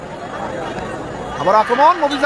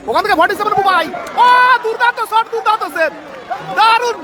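high, urgent play-by-play; frantic football commentary; 10 semitones higher, 3 dB louder than usual. Real, not acted. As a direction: call the shot and save breathlessly. Near miss in commentary.

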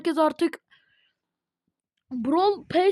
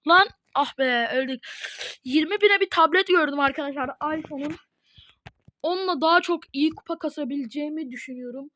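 normal talking voice

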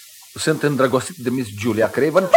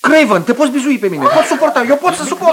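high-pitched, insistent assurances; protesting endurance; 10 semitones higher, faster than usual. Acted. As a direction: insist you can take the blow. Rival declares he can bear it. (asigurări insistente, pe ton înalt)

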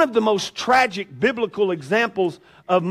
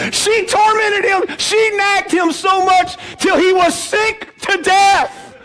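loud, high, slow tormented; preaching shout; 10 semitones higher, 5 dB louder, slower than usual. Shown as a demonstration. Preacher hammering the verse to the congregation, loud and slow. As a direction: belt it out at full force.

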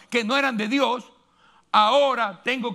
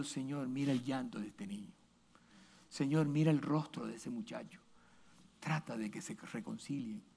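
soft, pleading prayer voice, much softer; 8 semitones lower, 15 dB quieter than usual. Retold or imitated. The pastor prays for the crying child, soft and pleading. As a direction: plead gently with the Lord. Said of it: "Por eso cuando yo oro".

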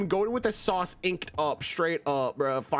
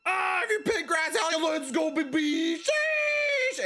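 high, drawn-out hype yell ending in a squeal; hyped-up shout; 7 semitones higher than usual. Pretend. Streamer hyping up viewers as the game starts, hyped-up shout. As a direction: yell it high, climbing into a screech.

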